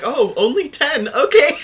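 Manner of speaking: high, loud mock surprise breaking into laughter; mock reaction. Player replays reaction to only ten, loud mock surprise. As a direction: exaggerate the startled acceptance, pitch leaping up. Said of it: "I was like".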